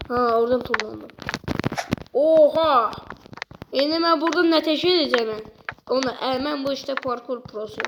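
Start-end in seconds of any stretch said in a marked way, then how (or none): none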